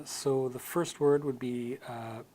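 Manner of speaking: ordinary delivery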